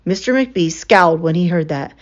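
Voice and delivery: plain reading voice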